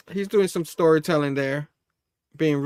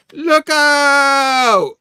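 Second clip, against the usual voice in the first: high, loud shout of alarm; warning shout; 11 semitones higher, 10 dB louder than usual. Acted. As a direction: yell out a warning. Bystander warning cry.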